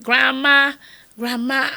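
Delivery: loud, high call for grandma; children calling grandma. Retold or imitated. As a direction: call across the house for grandma.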